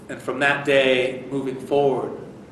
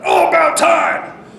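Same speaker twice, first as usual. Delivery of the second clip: slow, loud, high exasperated jab; huffing sarcasm; 8 dB louder, slower than usual. Retold or imitated. Staff sergeant greeting the late helicopter, slow sarcastic huff. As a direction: huff it out, fed up with the wait. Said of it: "he's huffing and puffing".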